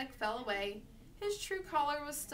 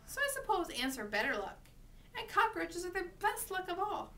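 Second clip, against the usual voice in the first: mock agreement, climbing high at the end; dry sarcasm; 7 semitones higher than usual. A narrator's reading. Mother mocks her son's talk of luck, sarcastic. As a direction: pile on the absurdity, pitching it higher. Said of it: "sounding very dry when she didn't believe".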